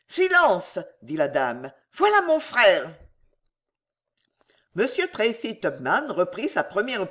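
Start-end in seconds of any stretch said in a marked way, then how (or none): 0.1-0.8 high, sudden hushing; alarmed lady's interjection; urgent warning. A narrator's reading. Lady hushes him as brother comes. 1.9-2.9 high, urgent alert; flustered lady sounding the alarm; alarmed warning. A narrator's reading. Warns of her brother's arrival.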